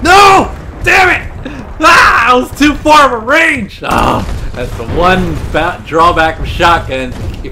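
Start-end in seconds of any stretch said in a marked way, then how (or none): 0.0-3.8 frustrated shout. Real, not acted. Missed kill, frustrated shouting.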